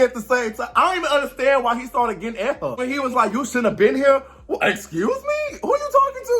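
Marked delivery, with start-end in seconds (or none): 3.3-6.4 high, exaggerated mock offense; mock indignant comeback. Imitating the women snapping back at him. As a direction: squeal the excuse me in disbelief.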